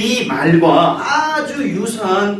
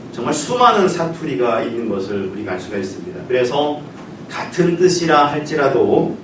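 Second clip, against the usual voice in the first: ordinary delivery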